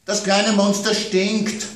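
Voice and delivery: put-on child's voice worried about monsters at bedtime; little girl. A narrator's reading. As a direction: play the small daughter scared of monsters.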